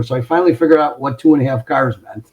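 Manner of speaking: ordinary delivery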